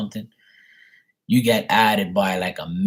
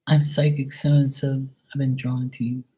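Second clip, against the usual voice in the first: mock psychic; 6 semitones higher than usual. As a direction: imitate a psychic's rehearsed introduction. Imitates psychics who hit them up.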